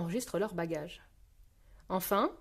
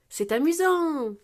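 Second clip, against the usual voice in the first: high, slow, drawn-out exclamation; delighted exclamation; 7 semitones higher, 5 dB louder, slower than usual. A narrator's reading. Peppa enjoying the chairlift, delighted exclamation. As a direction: exclaim it with swooping glee. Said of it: "s'exclame Peppa".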